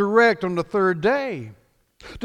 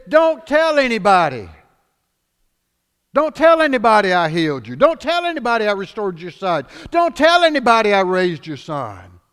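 forceful command; 7 dB louder than usual. Acted. Jesus' repeated command, loud.

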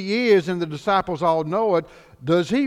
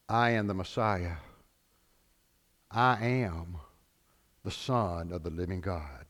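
hushed solemn declaration; 10 semitones lower, 6 dB quieter than usual. Acted. Jesus voiced, hushed solemn.

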